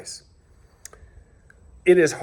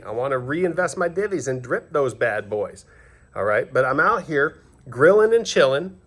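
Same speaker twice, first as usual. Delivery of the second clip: ordinary talk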